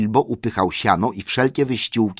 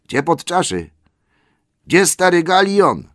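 high, searching call for the butler; an elf shouting around the cellar for Galion; calling out for the old butler; 10 semitones higher, 7 dB louder than usual. A narrator's reading. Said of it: "spytał któryś".

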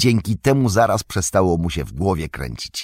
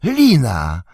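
high, loud cry of realisation; drawn-out excited shout; exclaiming; 5 semitones higher, 5 dB louder than usual. A narrator's reading. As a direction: call it out as a sudden discovery. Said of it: "zawołał Sam".

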